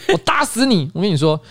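ordinary talk

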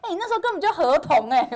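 loud, high teasing jab; mock husband teasing. Husband mocking his bald wife, loud and teasing. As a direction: tease her like a blunt husband.

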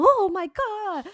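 high, slow Oh my God; shocked exclamation. Retold or imitated. Retelling finding a hornet nest, shocked exclamation. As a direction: gasp it out in mock shock.

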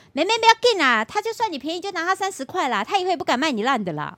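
high, loud granny taking charge; loud grandma. Retold or imitated. Grandma reassuring the girl and scolding vendor, high and loud. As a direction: call it out like a bossy old auntie in line.

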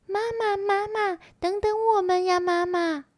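high-pitched little tadpole voice; tadpoles calling out. A narrator's reading. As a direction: call after mama in a high, childlike pitch. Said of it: "赶紧追上去大喊".

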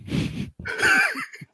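high, sudden burst of laughter. Real, not acted. Laughing at the question about a gangster family. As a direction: crack up in disbelief.

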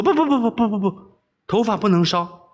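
high, rapid-fire refusals; alarmed protest. Acted. Princess protesting that her hair mustn't burn, alarmed. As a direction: plead up high to stop him. Princess begs him not to.

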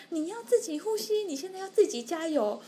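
high, urging nurse voice; a lightly voiced nurse pushing a patient to breathe alone; nurse encouraging. 护士鼓励病人自己呼吸. (高音鼓励病人自己呼吸的护士)

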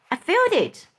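a friend insisting they rise; excited. A narrator's reading. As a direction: insist it's lifting.

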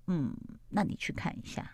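soft, whispered retold order; barely voiced, understated instruction; hushed quote. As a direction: drop to a near whisper for the quote. Retold superior quietly sending her off.

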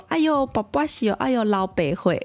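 lightly voiced grandma cooing in Taiwanese; a hint of grandma soothing a small child; mock grandma comforting a bumped toddler; imitating grandma. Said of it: "有时候阿嬷，就我妈".

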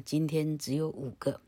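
usual speaking voice

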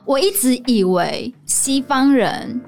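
ordinary talk

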